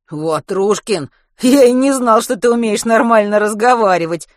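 put-on boy stunned his friend speaks normally. A narrator's reading. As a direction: blurt it out in disbelief. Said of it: "ошеломлённо сказал Матвей".

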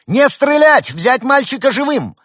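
very high, loud command; military order. A narrator's reading. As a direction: shout orders over the assault. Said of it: "приказала майор".

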